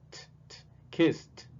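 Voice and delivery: slow "T, t, kissed" stressing the T; isolating the T. Shown as a demonstration. Teacher drilling the final T. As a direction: tap out the T, then say "kissed". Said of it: "Pueden escuchar la T allí".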